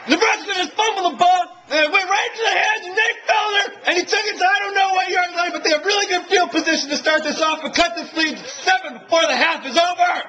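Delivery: high, loud, rushed shouting; barely holding it together; thrilled play-by-play yell; excited recap. Real, not acted. Excited recap after fumble.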